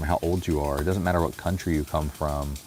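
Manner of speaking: ordinary talk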